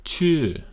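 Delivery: Chu starting high, rising, then dropping far; high falling Chu; falling tone demo. Shown as a demonstration. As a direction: Chu up over the hill and way down. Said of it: "You go up and you come down".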